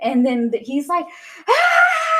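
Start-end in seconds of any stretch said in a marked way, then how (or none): 1.5-2.2 a very high, loud ecstatic yell; excited scream. Retold or imitated. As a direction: shriek with pure joy at the big moment. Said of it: "screaming and he's, like, so excited".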